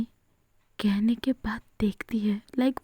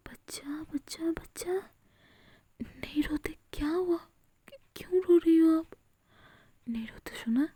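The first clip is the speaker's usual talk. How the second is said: tender soothing; 6 semitones higher than usual. Acted. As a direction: hush her like a child, gently. Yuvika soothes crying Avni. (प्यार से चुप कराती हुई, कोमल ऊँची आवाज़)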